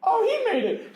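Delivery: high, loud joyful cry; excited relief. Acted. GM voicing party spotting Ida, excited cry. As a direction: call out in delighted relief.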